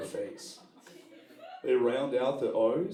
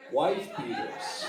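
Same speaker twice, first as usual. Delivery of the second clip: slow punchline; 6 semitones higher, much slower than usual. Acted. Punchline with comic timing.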